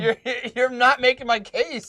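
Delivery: high, loud acted protest; mock exasperation. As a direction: object in hammed-up dismay. Acting out his appalled reply.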